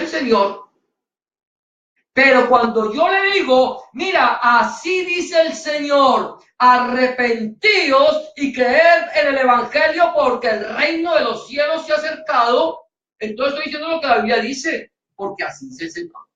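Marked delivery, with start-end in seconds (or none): 3.9-12.9 slow, shouted preacher proclamation; a preacher thundering at the crowd to repent; proclaiming. Acted.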